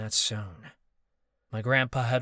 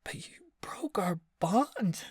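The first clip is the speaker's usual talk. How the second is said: higher, slow whimpering whisper; 10 semitones higher, 3 dB quieter, slower than usual. Acted. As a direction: whimper it like a hurt child. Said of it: "Marcus whimpered".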